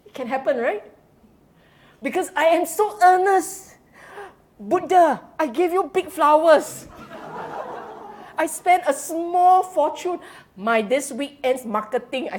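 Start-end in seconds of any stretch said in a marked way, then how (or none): 2.0-10.2 mock pleading devotee.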